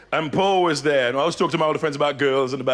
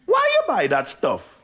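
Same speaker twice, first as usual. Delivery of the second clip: accusing Jamaican woman, higher and slow; stern Jamaican mum voice, pitched up; old Jamaican cleaning lady; 4 semitones higher than usual. Retold or imitated. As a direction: accuse him in a drawn-out Jamaican accent. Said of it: "Old Jamaican cleaning lady".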